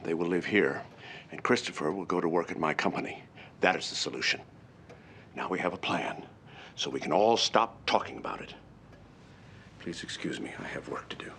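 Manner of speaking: low, measured pronouncement; stern, low-voiced decree; cold finality. Patriarch coldly ends the argument.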